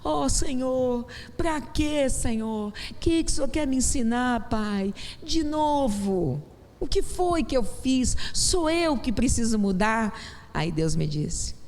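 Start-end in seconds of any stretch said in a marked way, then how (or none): 0.0-10.1 raised and drawn-out searching plea; slow, higher, asking God what to learn; pleading prayer. Retold or imitated. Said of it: "um dia eu estava orando assim no quarto".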